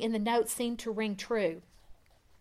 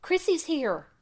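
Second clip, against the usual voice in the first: higher, eager announcement; excited news; 7 semitones higher than usual. Acted. Wife telling husband their daughter is home, excited. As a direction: tell him the big news at once. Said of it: "she blurted".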